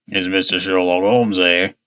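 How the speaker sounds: lightly voiced old sailor, low and gruff-edged; a hint of an old sea dog asking at the door; old seafarer voice. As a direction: speak it like a weathered old seaman. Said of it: "seafarer voice".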